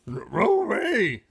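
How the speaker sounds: a lightly voiced cartoon dog; Scooby bark. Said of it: "Scooby barked".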